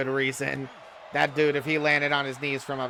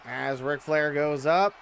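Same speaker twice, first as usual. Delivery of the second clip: wrestling commentator; 5 semitones higher, slower than usual. Acted. Live wrestling game play-by-play.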